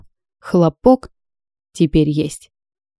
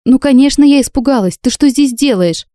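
flustered outburst; 6 semitones higher than usual. Acted. Heroine scolding Dam for barging into her bath, flustered outburst. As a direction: snap back, rattled and indignant.

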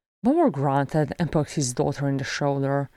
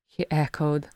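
ordinary delivery

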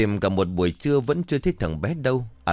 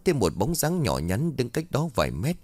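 usual speaking voice

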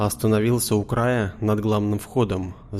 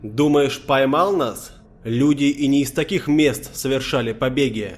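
shouting, defiant; 4 semitones higher than usual. A narrator's reading. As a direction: roar defiance at the building. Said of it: "Вскипел здоровяк и заорал".

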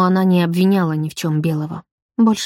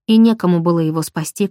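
plain reading voice

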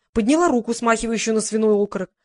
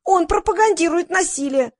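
high-pitched protest; a mild yell of outrage; indignant yelling; 8 semitones higher, 4 dB louder than usual. A narrator's reading. Front-row woman scolding Roman for promoting violence, yelling. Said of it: "завопила толстая тётка".

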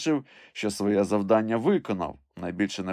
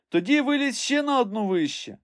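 high-pitched order sent upward; calling orders. A narrator's reading. Master sends climber one branch higher, high call. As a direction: call the command up the tree.

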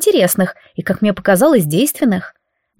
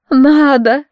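high, loud whiny echo; nasal whine; 5 semitones higher, 4 dB louder than usual. Acted. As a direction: whine the word Надо through the nose. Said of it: "проныла оборотниха".

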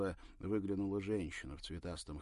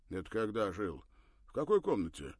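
loud, wary questioning; suspicious man; 3 semitones higher, 9 dB louder than usual. Acted. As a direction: press her with suspicion. Suspicious neighbor questions her.

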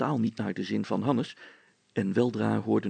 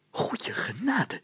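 breathless, awed whisper; rapt whisper; 12 semitones higher, slower than usual. A narrator's reading. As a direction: whisper it in delight. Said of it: "fluisterde de burgemeester verheerlijkt". (verrukt gefluister)

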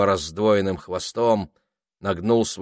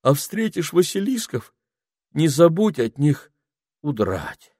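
put-on teasing boy; 7 semitones higher than usual. A narrator's reading. Simon teasing, voice shifted.

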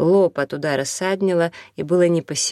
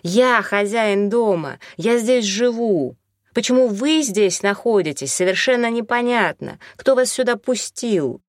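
emphatic, higher-pitched indignant protest; shouting; 5 semitones higher than usual. A narrator's reading. Owner demands answers from squatters, moderate shout. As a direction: insist in a raised, higher voice. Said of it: "закричал он".

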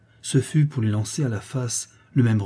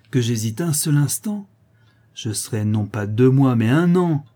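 put-on defiant child; 3 semitones higher, 5 dB louder than usual. Boy choosing punishment over apology, defiant. Intractable boy defies mother.

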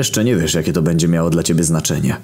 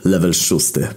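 ordinary talk, nothing marked

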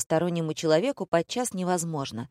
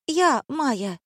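high squeaked self-introduction; squeaky girl; 9 semitones higher, slower than usual. A narrator's reading. Girl in red answering the director, squeaking. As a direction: pipe up in a high little voice.

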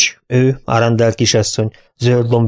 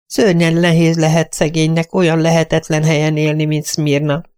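high, hesitant, eager-to-please voice; a flustered lady's companion, much higher; timid companion voice; 6 semitones higher than usual. Acted. Timid companion explaining the rooms to her mistress, high and eager.

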